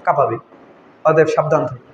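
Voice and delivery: low-pitched, falling warning; grave warning. Real, not acted. Preacher cautioning the congregation about the grave, grave warning. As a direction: drop the voice low to warn them.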